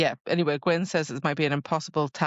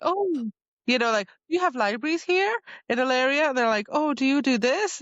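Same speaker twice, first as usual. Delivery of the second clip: chatty curiosity; 8 semitones higher than usual. Retold or imitated. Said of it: "They're just like".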